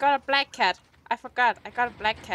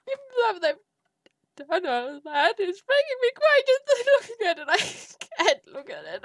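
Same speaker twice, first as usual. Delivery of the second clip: mock sob; 6 semitones higher, slower than usual. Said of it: "even though I'm crying".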